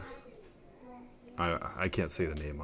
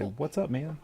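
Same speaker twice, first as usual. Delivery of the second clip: put-on higher, sing-song prompt for the child; parent modelling a greeting; 10 semitones higher, slower than usual. Shown as a demonstration. Parent coaching toddler to greet, high sing-song. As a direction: say it brightly so the kid copies.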